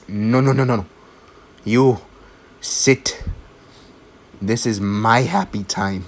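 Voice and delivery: acted-out firm, emphatic shushing; mock-stern order with punched words; bossy shushing. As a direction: put someone in their place, word by word. Deku silences Bakugo in retelling.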